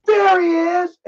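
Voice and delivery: high, loud call of there he is; a loud pointing shout across the theater. Retold or imitated. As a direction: yell it out to the whole lobby. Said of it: "he's like".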